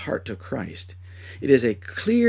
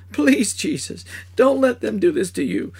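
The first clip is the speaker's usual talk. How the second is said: much higher, louder desperate plea; desperate begging; 10 semitones higher, 4 dB louder than usual. Acted. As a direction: beg him to save himself. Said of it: "I begged".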